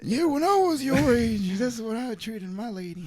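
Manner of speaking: put-on high, slow creaky old-timer; old man voice. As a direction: play a doddering grandpa reminiscing.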